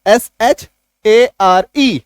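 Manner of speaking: एसएचएआरई spelled slowly, letter by letter; spelling letter by letter. Shown as a demonstration. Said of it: "इसी एआरई को जब आप add करते हैं एसएच के साथ". (एसएचएआरई को धीरे-धीरे एक-एक अक्षर बोलें)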